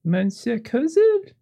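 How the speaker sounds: put-on very high, slow little French girl's voice. Little girl saying good night to a guest, small high voice. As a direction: say a polite goodnight as a small French child.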